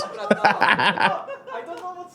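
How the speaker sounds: high-pitched delighted laugh. Real, not acted. Laughing at the ace.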